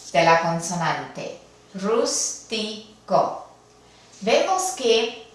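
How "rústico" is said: "Rústico" slowly split into three separate syllables. Shown as a demonstration. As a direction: break "Rústico" up as rús, ti, co with gaps. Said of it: "Vamos a dividirlo por sílabas". ("Rústico" dividido despacio por sílabas)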